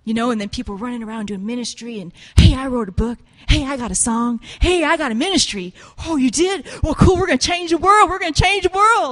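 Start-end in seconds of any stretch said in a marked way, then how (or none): 2.3-9.1 mock hype. Mocking self-promoting ministry people.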